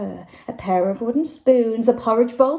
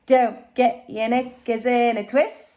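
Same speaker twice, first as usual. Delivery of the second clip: calm Jack. Acted. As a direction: brush off the panic coolly. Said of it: "said Jack".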